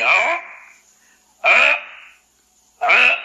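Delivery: retch out آ as if about to vomit; imitated vomiting sound from the throat. Shown as a demonstration. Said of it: "جب قے آ رہی ہوتی ہے تو آواز کون سی نکلتی ہے".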